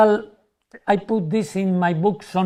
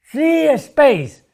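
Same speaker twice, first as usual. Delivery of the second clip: 1 excited spectator; 7 semitones higher, 5 dB louder than usual. Retold or imitated. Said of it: "people are emotional".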